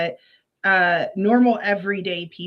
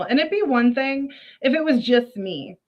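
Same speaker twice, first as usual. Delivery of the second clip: normal talking voice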